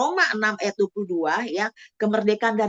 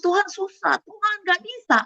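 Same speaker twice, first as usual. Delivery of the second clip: whiny plea; 8 semitones higher than usual. Retold or imitated. Believer complains to God.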